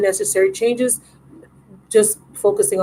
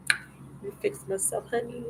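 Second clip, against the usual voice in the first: whispered aside; 3 semitones higher, 16 dB quieter than usual. Real, not acted. Fixing her looks on camera.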